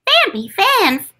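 high squeaky creature cry; Phanpy voice. Acted. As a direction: squeak the name like a baby elephant. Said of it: "Phanpy in Pokemon".

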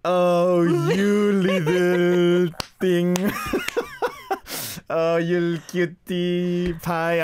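slow, high doting coo. Shown as a demonstration. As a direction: coo over a cute child. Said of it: "how would you translate 오구".

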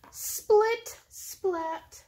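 high, loud punchy opening words; excited storytelling. A narrator's reading. Reading to her cats, bouncy excited opening. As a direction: pop each sound word out to grab attention.

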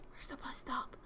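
hushed whisper. Real, not acted. Lost at night, whispering to herself. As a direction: whisper it so nobody hears.

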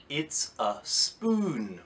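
very slow "It's a spoon" with long "oo"; slow modelled word. Shown as a demonstration. Teacher naming the picture slowly for pupils. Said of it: "with spoon".

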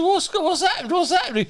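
high-pitched mock alarm; comic imitated confusion; retold bewilderment. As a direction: act out someone woken into chaos. Supposedly dead man wakes bewildered.

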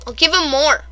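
ordinary talk

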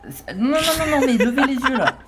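high laughing teasing; en riant. Real, not acted. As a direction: laugh while pushing him on. Commandant taquine un agent timide. (en riant, plus aigu)

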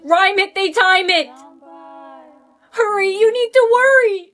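high, loud, urgent rhyme; urgent yelling. Acted. Urging a friend to find a rhyme, yelling. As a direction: yell it as a pressing warning. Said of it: "Stop yelling at me".

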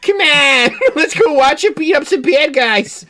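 put-on high, loud, giddy fan kid; excited kid voice. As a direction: gush like an overexcited kid.

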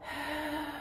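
soft long breath; soft, drawn-out sigh; deep breath. Acted. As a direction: breathe slowly to calm down. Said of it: "Take a deep breath".

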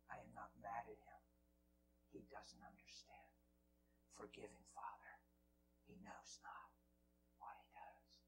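low, near-whispered prayer; very soft and low, almost to yourself; hushed forgiveness. Retold or imitated. Preacher praying for the man who mocked him, hushed.

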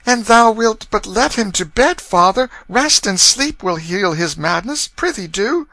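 put-on high-pitched girl begging her father; pleading girl. A narrator's reading. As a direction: coax father on a brother's behalf. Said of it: "began to plead timidly for their brother".

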